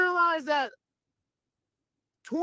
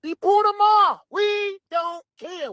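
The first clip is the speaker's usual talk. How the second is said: careless mock shout; 8 semitones higher, slower than usual. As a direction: toss it off like nobody cares. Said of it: "are sitting here like".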